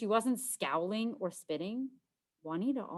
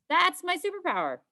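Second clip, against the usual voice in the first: proud reveal; 12 semitones higher, 6 dB louder than usual. Acted. Proud belly button reveal.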